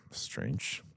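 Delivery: soft, low puzzled murmur; hushed puzzlement; puzzled aside. Retold or imitated. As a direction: wonder quietly to yourself. Puzzled by unexpected call.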